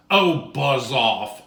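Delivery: loud, slow snap; rude dismissal. Acted. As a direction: brush him off rudely. C rudely dismisses B.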